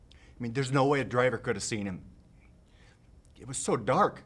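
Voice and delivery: loud, high, anguished protest; anguished outburst. Acted. Anguished climax of acted monologue.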